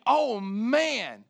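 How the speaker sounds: "Oh man" loud, with MAN drawn out; great emphasis. Acted. Preacher voicing Jesus to those who go, emphatic. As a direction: exclaim "Oh man" as a heartfelt loud promise. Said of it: "a word of emphasis".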